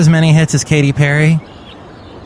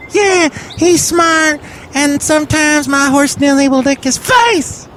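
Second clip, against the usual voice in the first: very high falsetto shout, stretched out; Benita, proud wife character. Acted. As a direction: squeal it in a piercingly high character voice.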